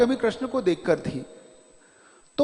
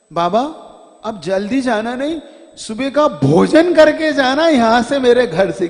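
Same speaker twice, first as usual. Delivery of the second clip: loud pleading devotee; 2 semitones higher, 8 dB louder than usual. Retold or imitated. Devotee loudly begging the guru to eat before leaving. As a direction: beg the guru to stay, voice raised. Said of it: "क्या कहता है?".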